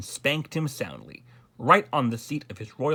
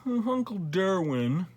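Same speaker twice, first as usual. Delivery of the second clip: high, slow drawn-out yawn; 6 semitones higher, slower than usual. A narrator's reading. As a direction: stretch it out through a lazy yawn. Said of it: "yawned the Grand Duke Wilfred".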